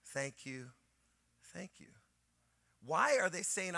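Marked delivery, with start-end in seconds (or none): 0.0-1.9 softer, fading to almost nothing; soft thanks. Retold or imitated. Friend thanking the pastor quietly, fading out. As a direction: murmur grateful thanks, trailing away. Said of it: "She goes".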